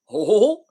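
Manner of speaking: hammed-up cartoon laugh; Goofy laugh. Shown as a demonstration. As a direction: bark out a cartoon dog's laugh. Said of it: "I can't do the laugh".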